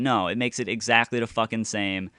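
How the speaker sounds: ordinary delivery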